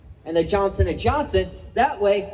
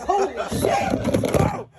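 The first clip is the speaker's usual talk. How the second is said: high and very slow "holy shit"; drawn-out holy shit; 13 semitones higher, much slower than usual. Real, not acted. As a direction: stretch it out amazed. Stretched high exclamation.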